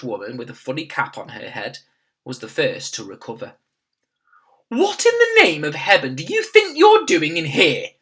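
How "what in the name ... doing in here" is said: higher, louder scolding maid; shouting angrily. A narrator's reading. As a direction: tell the intruder off. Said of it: "she shouted angrily".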